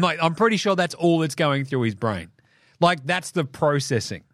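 normal talking voice

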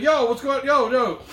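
high, loud searching calls; calling out. Retold or imitated. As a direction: call out for a friend who vanished. Calling for the missing friend.

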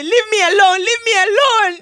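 rising, loud, tearful plea to be left alone; tearful anger. Acted. Worried mother begs the joking friend to leave her alone, tearful. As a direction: push him away through tears.

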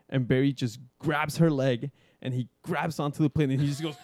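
ordinary delivery

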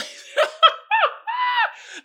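very high laugh that breaks loud; high shrieking laugh. Real, not acted. Laughing at his own point, shrieking high laugh.

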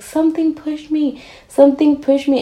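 mildly panicked quote, not screamed; retold frightened cry. Friend crying out after being jolted back, mild retold panic. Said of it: "she screamed, and she was shaking".

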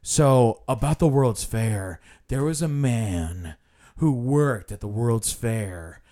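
slow, measured storyteller imitation; hammed-up tour guide delivery, drawn out; dramatized tour guide. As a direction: drone through the history with mock drama. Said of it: "he's just like".

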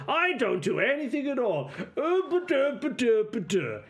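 sing-song, self-mocking buffoon; exaggerated boastful-then-whiny parody voice; mocking doppelganger. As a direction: swoop up and down like a dopey caricature. Game master voices a distorted memory copy.

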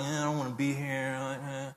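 put-on half-hearted sulky teen; bored teenager. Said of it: "being in that teenage phase of, like".

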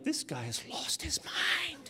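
high, hushed, drawn-out disbelief; stunned inner voice, stretched out; incredulous aside. Retold or imitated. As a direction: think it aloud, slowly, in a high soft voice. His stunned thought about the man.

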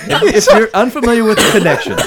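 high laughing read. Real, not acted. Laughing while reading news.

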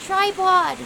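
high, loud scream of a name; urgent high yell; screamed call. A narrator's reading. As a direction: scream out to a friend in peril. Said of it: "screamed Quincy".

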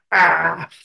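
usual speaking voice

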